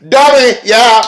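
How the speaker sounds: high, loud yell; shout. Real, not acted. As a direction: bellow it at the dummies. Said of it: "yelling at fans".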